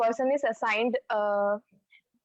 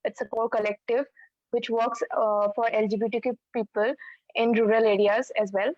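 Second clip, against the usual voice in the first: ordinary talk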